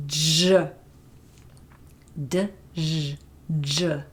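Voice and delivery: heavily drawn "J" split into "d, zh"; exaggerated j. Shown as a demonstration. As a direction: overdo every part of "j". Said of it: "So I'll really exaggerate that".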